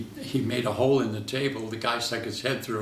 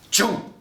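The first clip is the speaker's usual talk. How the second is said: high, loud mouth noise for a slash; razor sound effect; 9 semitones higher, 6 dB louder than usual. Retold or imitated. Retelling the throat cut, a loud slash noise. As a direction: spit out a sharp slashing sound.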